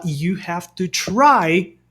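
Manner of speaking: very slow, clear You have to try; slow example. Shown as a demonstration. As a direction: spell it out for learners. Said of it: "Em inglês você vai falar".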